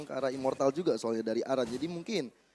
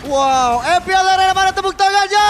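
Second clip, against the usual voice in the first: big arena hype call; crowd hype shout; 14 semitones higher, 15 dB louder than usual. Real, not acted. Caster hypes crowd.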